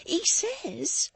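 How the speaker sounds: breathless whisper before a laugh. A narrator's reading. Maid whispering before bursting into laughter. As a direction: hold back a laugh. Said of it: "burst into a violent hysterical laugh".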